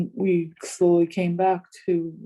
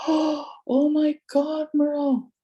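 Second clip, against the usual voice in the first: slow, higher-pitched astonished gasp of words; retold awe; 9 semitones higher, much slower than usual. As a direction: draw it out in hushed-up wonder, higher. Retold awe at beams of light.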